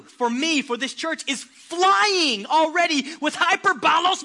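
loud, driving preacher's call; hammering each strike, voice ringing out; fiery preaching. Acted. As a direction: whip the church up to passion. Preaching at full force.

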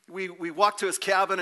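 usual speaking voice

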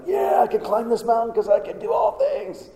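high, hammed-up boastful believer; mock boastful. Preacher mocks Christians quoting the verse, high and cocky. Mocking how we quote the verse.

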